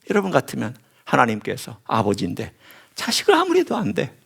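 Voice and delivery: ordinary delivery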